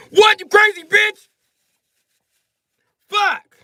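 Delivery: a loud yell of outrage; mock outraged yell. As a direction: shout it high and loud, slowly. Guys react to stampede warning.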